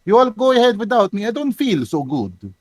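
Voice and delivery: high then falling Russian accent attempt; Creek's put-on accent. Player retries Creek's line, put-on Russian accent. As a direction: start high, let the put-on accent sink. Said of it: "where have your accent gone?".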